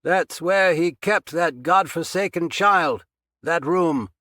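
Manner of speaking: loud, high voice calling across the basement; calling from far off. A narrator's reading. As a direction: project it from the far side of the room. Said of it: "he sounded like he was on the opposite side".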